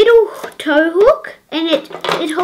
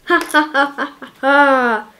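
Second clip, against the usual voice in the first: gloating toy-villain cackle; a kid's mock evil laugh. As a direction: laugh like a bad guy who just won. Toy villain gloats over stolen gems.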